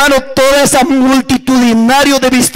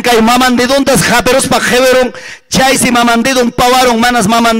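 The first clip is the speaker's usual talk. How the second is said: ordinary talk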